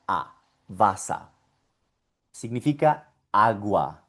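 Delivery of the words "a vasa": "a" alone, then "vasa" ending the same; lower, clear open A closing "vasa"; final A demo. Shown as a demonstration. Teacher concluding how it sounds, low "a, vasa". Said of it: "por lo tanto suena".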